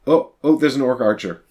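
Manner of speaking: ordinary talk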